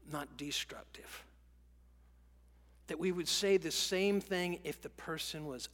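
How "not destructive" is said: whisper. Acted. Sermon contrast emphasis.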